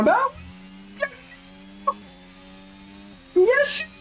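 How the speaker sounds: very high mock retort; mock high bien sûr; sarcastic. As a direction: squeak it sarcastically.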